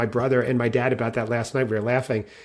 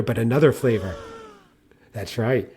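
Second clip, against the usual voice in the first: normal talking voice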